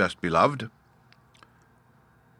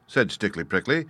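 ordinary delivery, nothing marked

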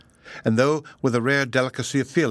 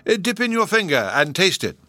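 lightly voiced jolly old professor, raised; bustling, pleased old scholar; 9 semitones higher, 3 dB louder than usual. A narrator's reading. Professor tells the children they are expected, jolly.

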